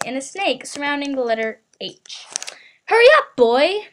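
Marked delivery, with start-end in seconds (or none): 2.9-3.9 sudden loud bark of an order; shout. A narrator's reading. Uncle shouting at the boy from the kitchen, loud. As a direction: yell it down the hall, impatient. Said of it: "shouted Uncle Vernon".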